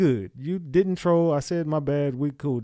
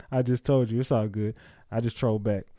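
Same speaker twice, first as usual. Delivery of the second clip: ordinary delivery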